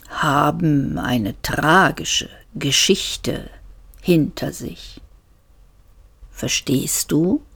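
slow, soft disembodied voice; a calm, low-key mysterious voice; deep, gentle voice. Acted. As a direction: speak slowly and softly, like a voice from nowhere. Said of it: "Die Stimme war tief und sanft".

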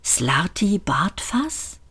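drawn-out, softly puzzled question; incredulous echo. A narrator's reading. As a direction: echo the name in hushed disbelief. Arthur echoes the name in disbelief.

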